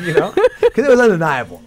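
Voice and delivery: laughing high and loud. Real, not acted. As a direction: laugh through the words. Laughing while telling.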